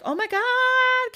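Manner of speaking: high, slow, loud shriek of a panicked servant. Acted. Servant bursting into princess's room, shrieking slowly and high.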